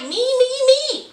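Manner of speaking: high whiny mock of a self-centered fiancée; mocking Tanya. Host mimics Tanya demanding everything, high whiny mock. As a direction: whine it like she only thinks of herself. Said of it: "she was like".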